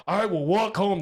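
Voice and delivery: hammed-up stubborn declaration; mock threat. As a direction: act out a hysterical threat. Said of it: "I was threatening".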